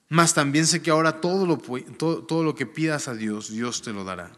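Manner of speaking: formal reading of the Gospel; scripture reading. Acted. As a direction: read the passage out to the congregation. Reads scripture aloud.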